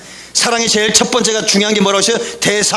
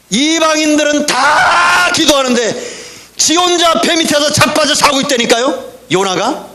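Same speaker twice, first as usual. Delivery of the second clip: higher, slow, shouted sermon climax; preaching at full force; 4 semitones higher, slower than usual. Acted. Pastor rebukes a sleeping congregation, shouting. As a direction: hammer it home from the pulpit.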